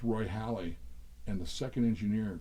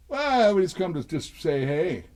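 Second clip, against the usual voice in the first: higher, louder, drawn-out casual answer; imitated musician's reply; 7 semitones higher, 7 dB louder than usual. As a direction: mimic a bandmate's slow, loud hello.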